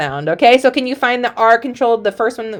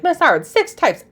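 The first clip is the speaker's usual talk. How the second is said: lightly voiced overwhelmed student, higher pitch; imagined student; 8 semitones higher than usual. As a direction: mimic a pupil protesting, pitched up. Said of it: "You're like".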